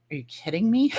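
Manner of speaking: laughing through the words; amused, can't believe she asked; father-in-law laughing at her question; laughing disbelief. Retold or imitated.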